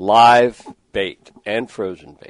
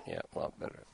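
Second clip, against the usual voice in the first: breathy unvoiced aside, much softer; whispered to himself; 19 dB quieter, faster than usual. Real, not acted. Host murmurs to his producer off-mic, whispered. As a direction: say it under your breath as an aside.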